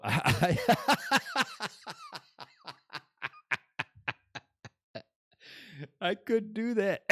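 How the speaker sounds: high-pitched laughing through words; real giggling delight; laughing at his own joke. Real, not acted.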